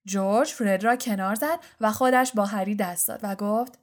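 usual speaking voice

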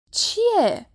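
high, cross one-word retort; sullen. A narrator's reading. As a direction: answer grumpily in a high voice. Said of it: "با قیافهٔ درهم و عبوسش گفت". (عبوس و بدخلق، با صدای زیر)

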